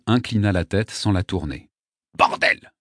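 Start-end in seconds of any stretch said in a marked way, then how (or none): 2.2-2.7 sudden rough curse; exclaiming. A narrator's reading. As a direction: burst out with the swearword. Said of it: "s'exclama le poète".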